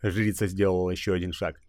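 ordinary talk, nothing marked

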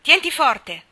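very loud, high urgent yell; yelled command. A narrator's reading. Dan yelling up at Jack to hold on tight. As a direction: bellow it so he hangs on. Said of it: "urlò allora Dan".

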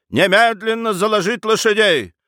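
high, urgent order; commanding call. Acted. Baroness rushing out and ordering horses, commanding.

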